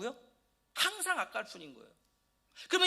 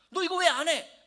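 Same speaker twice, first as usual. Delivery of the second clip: put-on loud, high scolding of a child; imitating angry parent; 7 semitones higher, 9 dB louder than usual. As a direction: snap at the kid like a fed-up parent. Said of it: "이러면서 화내고". (아이를 다그치는 부모처럼 크게 화내며)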